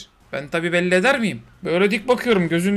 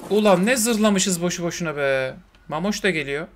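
ordinary delivery

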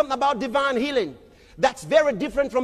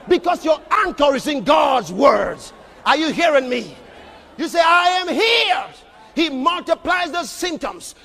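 full-force preaching; 6 dB louder than usual. Acted. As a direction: preach it hard to the crowd.

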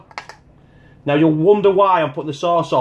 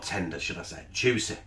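whispered aside; 9 semitones lower, 12 dB quieter than usual. Real, not acted. Savouring aside about the burger.

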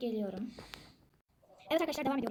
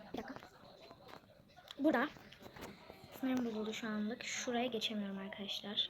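whisper; 3 semitones lower, 6 dB quieter, slower than usual. Real, not acted. Stuck in the game, whispers.

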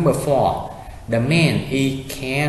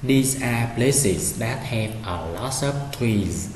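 slow reading; slower than usual. Shown as a demonstration. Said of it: "Tiếp tục".

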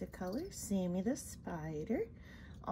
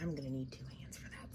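whispering; 7 semitones lower, 6 dB quieter, faster than usual. Real, not acted. Aside while handling spider.

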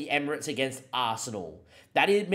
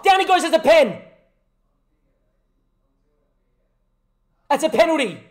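excited penalty shout; 10 dB louder than usual. Real, not acted. Penalty incident in the match.

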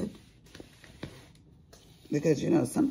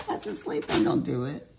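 much higher squeaky aside, fast; squeaky high quip; 3 dB louder, faster than usual. Real, not acted. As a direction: squeak it out as a joke.

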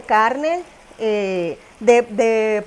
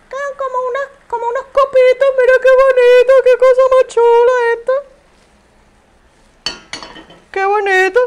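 high squeaky delight, much louder; excited squeal; 11 semitones higher, 6 dB louder, faster than usual. Real, not acted. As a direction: coo over it in a tiny high voice. Cooing over lettuce cups.

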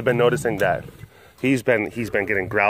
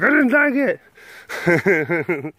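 high, drawn-out put-on reply; voicing her dislike; 6 semitones higher, much slower than usual. Giving her verdict on the movie, mock dislike. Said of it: "she's like".

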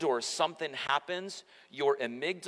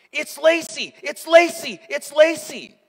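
much higher, much louder frantic calling; panicked child's cry; 13 dB louder than usual. Retold or imitated. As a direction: shout her name in a panic like a scared girl. Daughter crying out her name.

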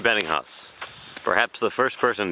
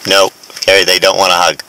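calling out; 13 dB louder than usual. Real, not acted. Scolding friend from afar.

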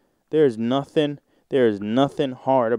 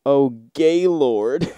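slow, louder hammed-up moan; a drawn-out fake moan, louder; mock moan; 3 semitones higher, 5 dB louder, slower than usual. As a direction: moan the name out like in bed. Moaning names read from sex thread.

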